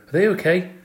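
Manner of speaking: high anxious question; worried man. A narrator's reading. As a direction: ask in a worried rush. Worried caretaker arrives.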